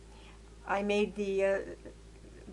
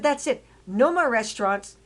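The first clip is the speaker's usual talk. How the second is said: loud, firm, hitting each more; laying down the law, louder and higher; angry ultimatum; 3 semitones higher, 6 dB louder than usual. Retold or imitated. Telling her mother no more food, loud and angry. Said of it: "And I said".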